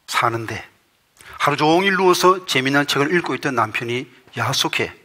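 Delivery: normal talking voice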